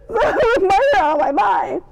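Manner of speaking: high, loud, drawn-out squeal; excited squeal. Acted. Fan reacting to Armand getting up, excited squeal. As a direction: shriek it slowly, overwhelmed with glee. Delighted squealed repeat of the line.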